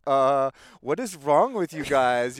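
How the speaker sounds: imitating a baffled, amused girlfriend; bewildered. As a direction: playfully act out her groggy bewilderment. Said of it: "you're looking around like".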